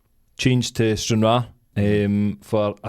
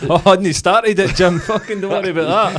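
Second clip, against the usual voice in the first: higher, louder, laughing mock boss; laughing impression; 8 semitones higher, 7 dB louder than usual. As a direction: play the easygoing manager while cracking up.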